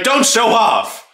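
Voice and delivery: high, drawn-out mock reprimand; mock scolding. Player telling a rival off, mock scolding.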